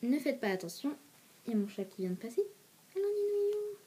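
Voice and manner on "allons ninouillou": high-pitched coaxing to a pet; pet-talk voice. Real, not acted. Speaker to her cat passing by, high pet-talk voice. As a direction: call the cat in a high little voice.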